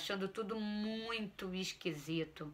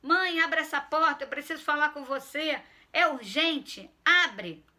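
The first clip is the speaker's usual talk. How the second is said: son yelling through the door, much higher and louder; demanding shout; 6 semitones higher, 7 dB louder than usual. Acted. Son shouts at locked door, loud demand. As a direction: pound on the door and yell for mom. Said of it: "Fui logo dizendo".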